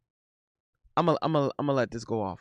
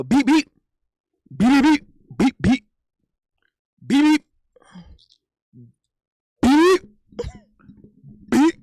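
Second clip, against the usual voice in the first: loud, high "beep" jabs with gaps; playful boxing sound effects; mimicked punch noises; 5 semitones higher, 6 dB louder than usual. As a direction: fire off "beep" punches in short bursts. Imitates boxing punches again.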